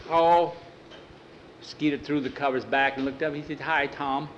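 put-on a dad catching kids roughhousing; amused father. Father finds boys fighting.

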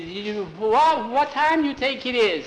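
high, loud frightened yell across the dark. Acted. Skeeter yells at Bowie in the dark, frightened. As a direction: yell out to your friend in fear. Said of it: "yelled at Bowie".

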